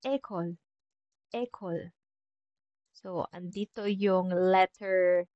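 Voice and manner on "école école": "école" with a closed first vowel, as in "day"; French pronunciation. Shown as a demonstration. Teacher giving the French word for school, closed é. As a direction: softly model "école", clear é at the start. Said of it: "school in French will be".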